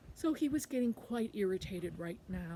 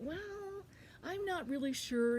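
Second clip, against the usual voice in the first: drawn-out, higher "well" of doubt; hesitant thought; 8 semitones higher, slower than usual. Acted. Quackling weighing the ladder's request, higher hesitant musing. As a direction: mull it over, unsure. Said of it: "he thought".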